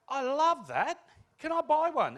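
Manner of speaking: high, loud gush of enthusiasm; delighted customer. Acted. Customer asking the store owner to sell one, delighted. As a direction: gush loudly like a thrilled shopper.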